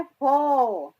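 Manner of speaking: loud, isolated "फो" starting on f; F sound. Shown as a demonstration. Teacher demonstrating the sound of F, loud "फो". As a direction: punch out "फो" as a single sound. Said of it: "एफ की sound कैसी आती है".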